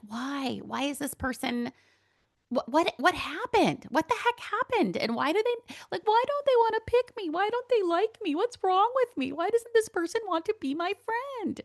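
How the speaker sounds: replaying her hurt thoughts to the listener, high and pleading; hurt inner pleading. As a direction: plead like a rejected kid asking why.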